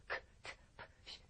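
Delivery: 크, 트, 프, 쉬 as bare puffs of air; unvoiced consonants. Shown as a demonstration. Teacher showing students sounds without vocal cords, 크, 트, 프, 쉬 voiceless. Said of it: "성대를 쓰지 않아요".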